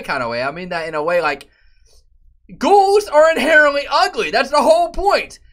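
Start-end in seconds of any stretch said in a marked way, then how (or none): 2.6-5.4 higher, louder ranting; exasperated rant. Real, not acted. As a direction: rant it with disbelief. Ranting about show.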